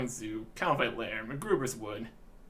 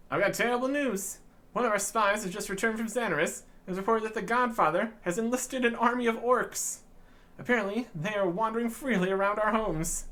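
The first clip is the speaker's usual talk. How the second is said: higher, worried fairy with bad news; urgent fairy advisor; 7 semitones higher, 3 dB louder than usual. Advisor warning the adventurer, lightly higher urgent voice. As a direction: report the orc army, a touch alarmed.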